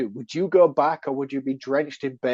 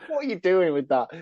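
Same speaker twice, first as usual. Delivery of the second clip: ordinary talk, nothing marked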